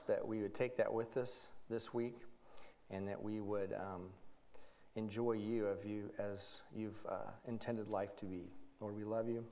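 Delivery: hushed, reverent prayer voice; softly praying to the Lord. Acted. Pastor closing his prayer, hushed and reverent.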